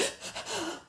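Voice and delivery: high, quick imitation gasps; mock huffing gasps; mad baby gasping. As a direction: mimic an infant's worked-up breathing. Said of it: "she gets so mad and she's like".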